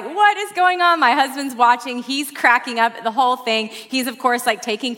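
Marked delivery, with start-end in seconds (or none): 0.0-1.0 higher mock disbelief; baffled. Preacher retelling TSA sleeve pat-down, mock baffled. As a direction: toss it up in bewildered disbelief. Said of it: "I am just like".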